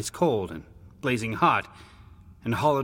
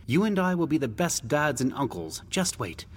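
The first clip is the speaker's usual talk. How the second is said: higher-pitched brotherly promise; reassuring big brother; 7 semitones higher than usual. Acted. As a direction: cheer up your little brother, voice lifted. Brother promises a shared future.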